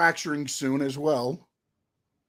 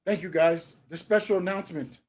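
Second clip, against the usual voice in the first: lightly mocked stiff announcer, softer; imitating TK; 3 semitones lower, 4 dB quieter than usual. Co-host mocking TK announcing Rampage, lightly stiff. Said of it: "He's like, he's like".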